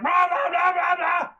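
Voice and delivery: imitated mom's high, loud nonsense yelling. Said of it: "my mom is yelling at me".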